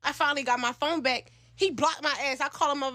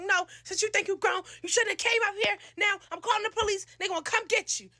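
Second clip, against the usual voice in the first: high-pitched threatening rant; scolding father; 8 semitones higher than usual. Retold or imitated. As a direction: imitate an angry dad laying down threats.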